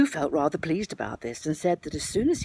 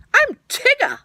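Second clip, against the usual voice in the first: much higher, louder bouncy Tigger; 10 dB louder than usual. Acted. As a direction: bounce in as the springy tiger. Narrator voices Tigger introducing himself.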